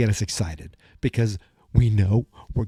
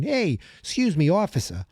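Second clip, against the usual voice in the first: higher-pitched mock New York caller; New York accent; 7 semitones higher than usual. Viewer phoning the Hawkins police, New York accent. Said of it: "have a New York accent".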